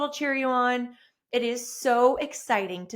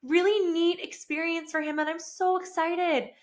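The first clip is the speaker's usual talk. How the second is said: high, fast, moved gushing; emotional excitement; 8 semitones higher than usual. Retold or imitated. Wife watching husband drive off, high and emotional. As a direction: gush while choked up with pride. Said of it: "this little choke up throat".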